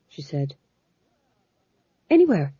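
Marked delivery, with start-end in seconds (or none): 2.1-2.5 hastily. A narrator's reading. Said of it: "said the Fool hastily".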